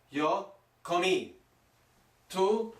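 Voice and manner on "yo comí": "comí" stressed on the final í; slow teacher demo, co-MÍ in "comí"; emphasis on last letter. Shown as a demonstration. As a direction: land firmly on the last vowel of "comí". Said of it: "put the emphasis on the last letter".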